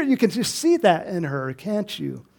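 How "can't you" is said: ordinary delivery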